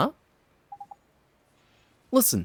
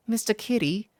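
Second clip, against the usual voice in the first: higher curious fairy question; 10 semitones higher than usual. Acted. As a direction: ask it like a tiny sprite. Fairy P recognizes name.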